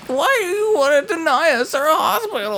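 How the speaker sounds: very high, crying plea; whiny campaigner. Acted. Mocking hospital campaigners to a co-host, high tearful whine. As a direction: wail it like a tearful, over-the-top protester. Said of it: "people complained about this voice".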